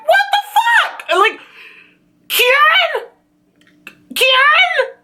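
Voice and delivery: shocked outcry. Real, not acted. Fan yelling at the screen over Karen's betrayal, shocked outcry. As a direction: shout the name in betrayed shock. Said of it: "viewer's own shock at twist".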